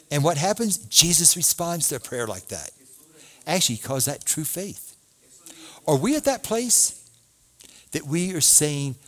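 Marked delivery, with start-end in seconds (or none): none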